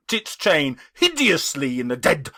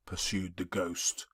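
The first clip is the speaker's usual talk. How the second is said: usual speaking voice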